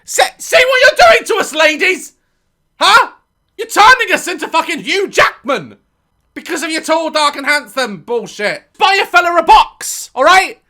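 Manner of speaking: loud, high comic rant; shouting a mock tirade at tall-loving women. Real, not acted. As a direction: yell it at the ladies. Ranting at women.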